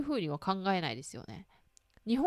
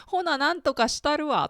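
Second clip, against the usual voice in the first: brisk Kansai-ben, raised and bright; Kansai dialect quote; 7 semitones higher, 5 dB louder than usual. Retold or imitated. Friend greeting her in sudden Kansai dialect, mock Kansai lilt. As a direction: friend showing off new Osaka-style talk. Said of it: "とか言って、言われて".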